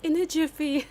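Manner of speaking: hammed-up British quip; mock British. Teasing the game's British character in a mock accent.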